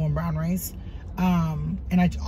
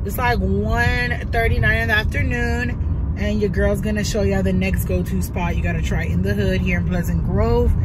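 ordinary delivery

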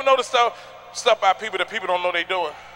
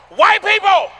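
calling out; 10 semitones higher, 8 dB louder, slower than usual. Acted. Calling out audience.

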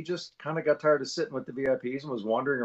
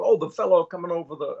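higher, louder Scottish star impression; Sean Connery; 6 semitones higher, 5 dB louder than usual. Sean Connery placing the announcer, imitated voice. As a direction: put on a Scot recognizing someone. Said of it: "He goes".

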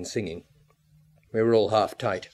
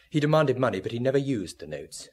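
ordinary talk

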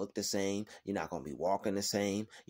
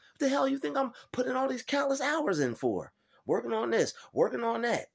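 fired-up rant; 12 semitones higher than usual. Real, not acted. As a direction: preach your own hustle at full heat. Fired-up rant about his grind.

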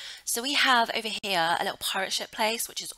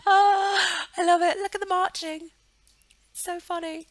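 much higher, louder gushing; delighted squeal; 11 semitones higher, 8 dB louder than usual. Real, not acted. Vlogger gushing over her marching Sims, high delighted squeal.

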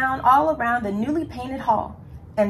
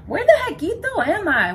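high, louder puzzled question; bewildered little storybook hero; wondering aloud; 10 semitones higher, 5 dB louder than usual. A narrator's reading. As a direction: wonder out loud, pitched way up. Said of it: "wondered Skipito aloud".

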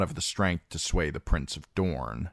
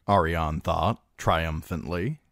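ordinary delivery, nothing marked

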